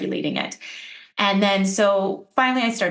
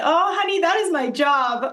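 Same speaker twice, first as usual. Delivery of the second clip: a higher, playful echo of the host's endearing line; imitating the host; 9 semitones higher than usual. Guest fondly repeats the host's phrase back to her, light playful imitation. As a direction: quote the host back with affectionate mock sweetness. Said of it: "I love how you said".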